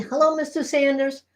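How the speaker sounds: normal talking voice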